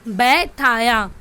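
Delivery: usual speaking voice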